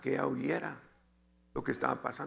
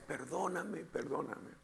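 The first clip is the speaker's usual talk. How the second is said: hushed, fading plea; soft pleading; 10 dB quieter than usual. Retold or imitated. Husband recalling all he could say to wife, softly pleading. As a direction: let the repeated plea sink away softly. Repeats his plea, much softer.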